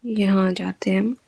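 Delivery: quick whispered aside. Real, not acted. Teacher whispering while opening the lesson. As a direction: murmur it under your breath.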